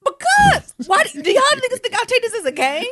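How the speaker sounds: loud, high-pitched comic outrage; indignant defence. Real, not acted. Defending her Bible reading to co-hosts, loud mock indignation. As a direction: burst out as if your faith is doubted.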